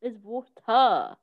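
"water" said slowly with a clear T; proper water. Shown as a demonstration. [name] showing how water should be said, slow and proper. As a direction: pronounce "water" carefully, every letter, slowly. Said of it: "I hate it when people say water".

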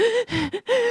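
high imitated gasp of a panicking baby; mother imitating her baby's gasping, high pitched; mock gasp. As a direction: gasp like the baby fighting for air.

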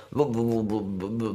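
imitated stammering bu bu bu; babbling. Boy trying to talk to his father, babbling bu sounds. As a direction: babble like a child who cannot speak.

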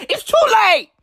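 high-pitched insistent cry; emphatic exclamation. Acted. Boozer refusing to change, high emphatic exclamation. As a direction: punch it out high after the song. Emphatic return after the song.

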